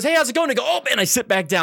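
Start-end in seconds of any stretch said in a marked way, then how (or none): 0.0-0.5 cheery boss greeting. Retold or imitated. Boss greets the stuck employee, high cheery hello. 0.7-1.0 startled reply. Retold or imitated. Caught off guard by the boss.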